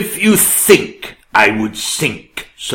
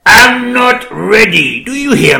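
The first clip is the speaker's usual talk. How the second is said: indignant; 11 semitones higher, 5 dB louder than usual. Acted. King refuses, voice rising.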